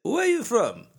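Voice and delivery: high, pointed question from the clerk; lightly voiced nosy clerk; mock clerk voice. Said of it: "Dude looks at me and says".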